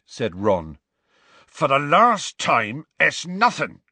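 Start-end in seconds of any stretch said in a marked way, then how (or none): 1.5-3.8 slow, raised, louder denial; insist, patience running out; firm. A narrator's reading. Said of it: "said Hagrid firmly".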